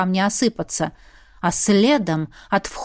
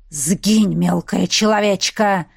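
demoness hissing; 4 semitones lower, slower than usual. Acted. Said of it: "прошипела демоница".

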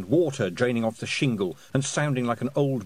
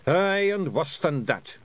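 higher, slow Scottish pixie warning; Rob Anybody; 6 semitones higher, 4 dB louder, slower than usual. Acted. Pixie leader warning of worse horrors, ominous Scots.

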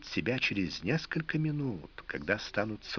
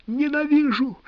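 hysterical screaming; 6 dB louder than usual. A narrator's reading. Wife screams hate.

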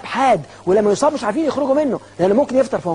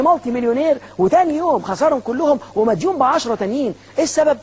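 ordinary talk, nothing marked

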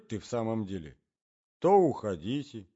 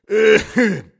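put-on old man's cough; 11 semitones higher, 15 dB louder than usual. A narrator's reading. Old man coughing in bed.

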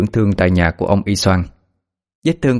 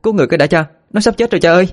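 put-on much higher, pleading girl's voice; urgent plea; 10 semitones higher, much faster than usual. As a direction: beg father to save him, urgently. Daughter begs father, dying man.

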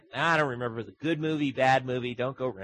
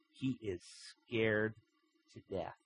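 softer, dropping away to almost nothing; hushed, ominous storytelling; hushed dread; 2 semitones lower, 7 dB quieter than usual. A narrator's reading. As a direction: let the fear sink in quietly. Narrator dwells on fear.

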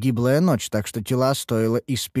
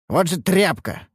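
higher, mildly scornful exclamation; contemptuous; 6 semitones higher than usual. A narrator's reading. Master disgusted at his weak apprentice, contemptuous. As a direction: spit it out in contempt. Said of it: "сплюнул я".

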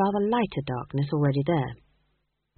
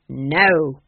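louder blunt no; curt refusal; 3 semitones lower, 5 dB louder than usual. A narrator's reading. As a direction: cut her off flat. Granny refuses.

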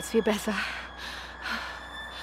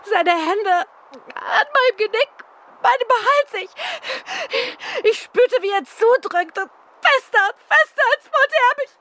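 high, breathless and frightened, words catching; a woman still reeling from being strangled, voice climbing in panic; terrified retelling of the attack, pitched high; shaken after attack; 9 semitones higher than usual. Acted. Victim just attacked, shaken.

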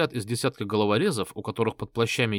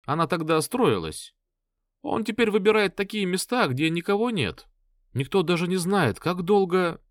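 lightly voiced worried woman; 7 semitones higher than usual. Blonde tells story, high.

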